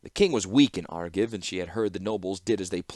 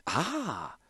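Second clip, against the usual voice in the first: high, much softer wondering ah; quiet first ah from the clockmaker; 7 semitones higher, 6 dB quieter than usual. Acted. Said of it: "then repeated for emphasis".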